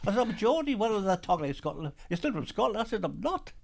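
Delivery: retold squabble, pitched well up; mock indignant protest. Geordie retells locals insisting he's Scottish, mock indignant.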